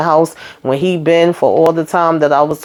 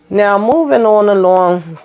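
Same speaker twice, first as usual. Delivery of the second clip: show segue; 3 semitones higher, slower than usual. Real, not acted. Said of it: "segue to next couple".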